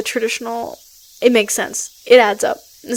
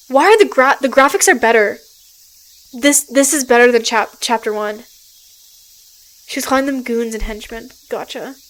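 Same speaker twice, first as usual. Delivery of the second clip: ordinary talk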